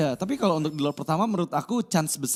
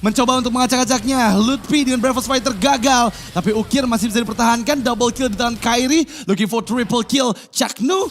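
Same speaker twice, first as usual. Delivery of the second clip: high, loud hype play-by-play; fighting game announcer; 5 semitones higher, 4 dB louder than usual. Acted. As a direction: call the fight at full hype. Live teamfight play-by-play.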